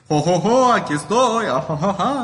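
high maniacal cackling voice; mad scientist. Acted. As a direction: cackle like a crazed villain. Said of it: "va a haber días donde".

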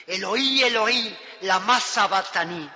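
Jesus crying out in anguish from the cross; loud cry. Acted. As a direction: cry out Eloí, Eloí with a full voice. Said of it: "Jesús clamó con voz potente".

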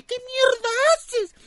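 very high, loud stretched shriek; screaming mother. Retold or imitated. Mother running after her son with the knife, high screamed imitation. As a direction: shriek it like a horrified mom. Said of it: "Hasta mi mamá salió corriendo detrás".

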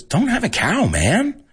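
high cartoon boy talking back; Bart Simpson. Son answering his yelling dad, high cartoon-kid voice. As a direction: pitch it up, brush off the scolding. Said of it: "And he goes".

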